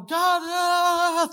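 very high, drawn-out mock wail; hammed-up tantrum cry to God; throwing a fit. Believers wail at God like a toddler, throwing a fit. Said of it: "throwing a fit down there".

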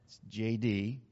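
soft low throwaway; quiet punchline. Real, not acted. Preacher softly dropping his nickname punchline. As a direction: toss off the nickname. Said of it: "nickname punchline".

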